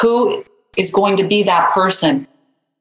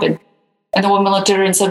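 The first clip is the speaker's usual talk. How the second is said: ordinary talk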